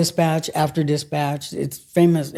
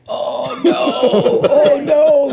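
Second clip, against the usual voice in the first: high, laughing oh no of being caught; mock dismay; 10 semitones higher, 3 dB louder, slower than usual. Kicked out of the line by the boss, laughing dismay. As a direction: groan out your dismay with a laugh. Realising she's in trouble.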